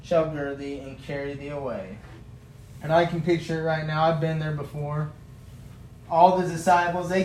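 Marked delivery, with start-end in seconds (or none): 0.0-2.0 soft, solemn reading of the verse; quiet King James scripture reading. Acted. Reads scripture aloud.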